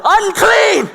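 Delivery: high, loud repeated shout; warning yell. Acted. Townspeople warning that a leper is out in public, yelling. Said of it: "people needed to yell".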